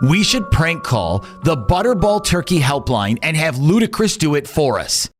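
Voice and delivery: ordinary talk, nothing marked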